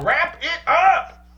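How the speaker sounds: high, slow stretched-out call; Dave Chappelle impression. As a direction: shout it like a comic on stage. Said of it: "The Dave Chappelle".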